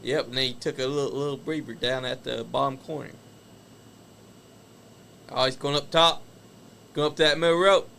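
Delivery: excited live wrestling play-by-play; wrestling commentator. Acted. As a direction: shout the big moves, call the rest. Calls the wrestling match live.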